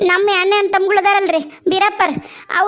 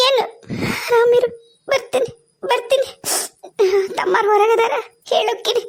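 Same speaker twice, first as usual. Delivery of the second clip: higher, laughing and crying at once; tearful goodbye; 5 semitones higher than usual. Acted. Mother's final farewell to daughter, tearful. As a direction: say goodbye while fighting tears.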